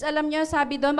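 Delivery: ordinary talk, nothing marked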